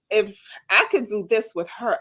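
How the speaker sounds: ordinary talk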